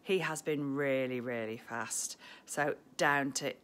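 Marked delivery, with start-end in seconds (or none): none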